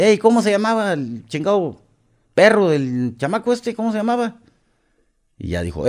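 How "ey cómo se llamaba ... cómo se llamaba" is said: higher mock call across the room; retold yell. Kidnapper calling to a partner about the dog, high yell. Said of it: "le gritó un güey".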